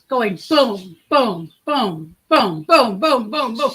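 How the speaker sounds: low thudding booms like a pounding pulse; imitating heartbeat. Host imitating her racing pulse to viewers, low thudding booms. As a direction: thump out each boom like a racing heart. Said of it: "I could feel my pulse".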